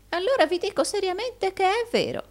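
very high-pitched earnest protest; high, pleading assertion; insistent old usurer. A narrator's reading. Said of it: "disse il vecchio Arturo".

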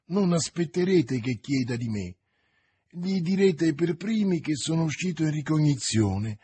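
ordinary delivery, nothing marked

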